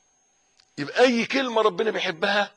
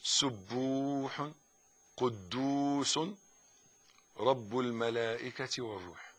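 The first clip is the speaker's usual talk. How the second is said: very slow and soft; drawn out, reverent and hushed; murmured bowing prayer; devout dhikr; 4 semitones lower, 11 dB quieter, much slower than usual. Shown as a demonstration. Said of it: "وانت راكع برضو تقول".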